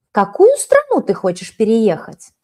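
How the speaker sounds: usual speaking voice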